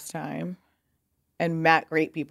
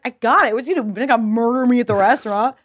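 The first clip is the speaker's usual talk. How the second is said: mock bravado; 6 semitones higher, 6 dB louder than usual. Bravely volunteering to approach Spector.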